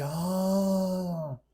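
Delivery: soft, drawn-out realizing "ah"; dawning realization. Retold or imitated. Son grasps why father mistreated him, quiet realization. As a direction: let it sink in slowly.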